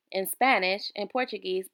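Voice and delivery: usual speaking voice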